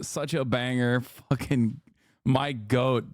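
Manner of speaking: ordinary talk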